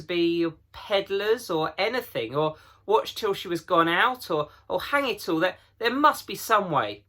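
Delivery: put-on a boy arguing for a rescue plan; Peter insisting. A narrator's reading. Peter argues.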